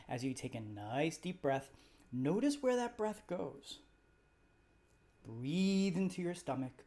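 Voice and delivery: calm, even meditation guide voice; guided meditation. Acted. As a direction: gently lead the listener through the body scan. Leads a guided body scan.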